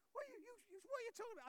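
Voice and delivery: high, tearful stammer; stammering distress. Retold or imitated. Pastor imitating Slava's shocked reply, high tearful stammer. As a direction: sputter in shock and upset.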